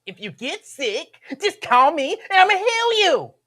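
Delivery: very high comic impression, swelling loud; Lincoln Ware. God promising healing, high loud Lincoln Ware voice. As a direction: climb high and belt out the end. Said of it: "You sound like Lincoln Ware".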